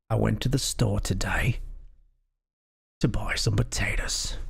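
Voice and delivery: Jason Statham-style British accent; British accent, a line from Snatch. Shown as a demonstration. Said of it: "to do a British accent".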